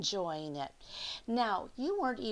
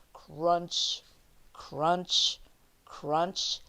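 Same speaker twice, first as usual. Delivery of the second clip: crunching sound words; 3 semitones lower, 7 dB louder, much slower than usual. Acted. Sound of feet in snow.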